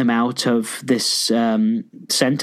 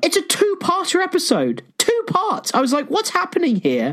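much higher, incredulous exclaiming; amazed excitement. Real, not acted. Host telling co-host of the two-parter, amazed. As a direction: burst out in disbelieving delight.

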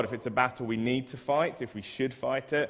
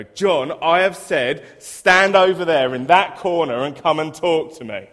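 loud, high bellow at a pupil; teacher's shout; 6 semitones higher, 13 dB louder than usual. Retold or imitated. Teacher shouting at John across the corridor, loud and high. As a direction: yell it down the corridor. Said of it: "walk up to him and go".

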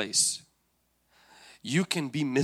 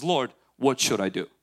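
calm, low, measured plea; composed prayer; 4 semitones lower than usual. Retold or imitated. David asks the Lord from strength, calm and low. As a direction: ask it steadily, with trust.

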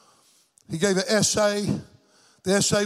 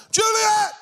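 high holler across the room; 14 semitones higher, 4 dB louder than usual. Retold or imitated. Preacher calls to his deaf granddaughter, hollering her name. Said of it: "I'll holler at her".

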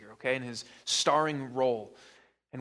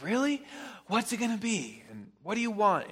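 put-on much higher, eager child's voice; excited kid; 8 semitones higher than usual. Pastor voices Jamie perking up at a promised treat, high and eager. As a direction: play the boy suddenly perking up.

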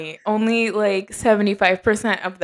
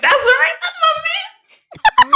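proud fangirl; 5 dB louder, faster than usual. Retold or imitated. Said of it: "And I'm like".

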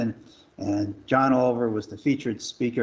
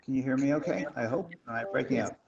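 breaking-up phone audio; clipped phone line; 3 semitones higher, 6 dB quieter, faster than usual. Real, not acted. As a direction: voice cutting out on a bad line. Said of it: "I'm afraid you're breaking up".

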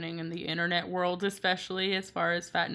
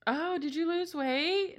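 mocking relative; 10 semitones higher, slower than usual. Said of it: "it's like".

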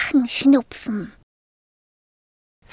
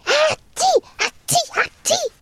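higher mock sneezes in a row; three sneezes; 13 semitones higher, 3 dB louder than usual. The sick dragon sneezes again and again, mock sneezes. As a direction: sneeze three times, hammed up.

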